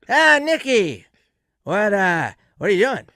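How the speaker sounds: loud, high-pitched cry of recognition; imitated excited greeting. Friend recognizes Nick on a call, exuberant imitated greeting.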